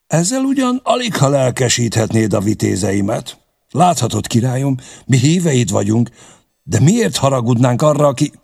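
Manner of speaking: high, animated, amused protest; lightly amused, pitched well up. A narrator's reading. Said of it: "Csanád elnevette magát".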